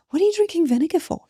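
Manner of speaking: high, quick, puzzled voice; baffled question. Retold or imitated. Neighbor tasting the water and asking, high and baffled. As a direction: toss it off high and fast, genuinely confused.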